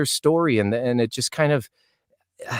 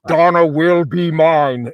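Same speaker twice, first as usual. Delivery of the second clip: slow, loud villain vow; 4 semitones higher, 5 dB louder, slower than usual. Retold or imitated. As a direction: drag it out like a sitcom villain. Acting his villain line.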